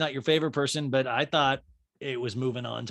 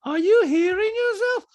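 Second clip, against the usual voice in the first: loud, very high, slow question; Diana; 8 dB louder than usual. Retold or imitated. Diana challenging Sutton, high loud impression. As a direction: mimic her challenge way up high. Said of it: "Diana's like".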